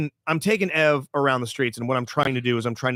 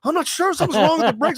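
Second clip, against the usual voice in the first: put-on lying kid excuse; 12 semitones higher, 3 dB louder, faster than usual. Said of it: "the first words goes".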